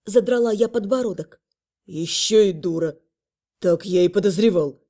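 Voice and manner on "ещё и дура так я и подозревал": low, smug dragon scoff. Acted. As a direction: scoff at her, unimpressed. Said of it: "хмыкнул ящер".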